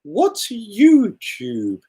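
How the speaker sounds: very high, very slow puzzled granny; old lady voice. Retold or imitated. Vlogger imagining the old lady's puzzled thought, high and slow. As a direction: stretch it out, baffled. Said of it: "I could just hear her thinking".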